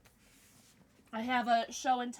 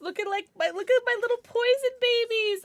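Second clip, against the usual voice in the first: high, gushing coo; 10 semitones higher, 3 dB louder than usual. Real, not acted. Streamer showing off her plushie babies, doting high coo. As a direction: gush over them in a raised, cooing voice. Dotes on plushies, near tears.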